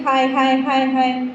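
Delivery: a voice calling hi a few times. Shown as a demonstration. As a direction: call out hi as the speaking voice. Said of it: "एक आवाज़ दे रही है".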